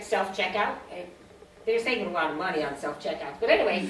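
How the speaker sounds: normal talking voice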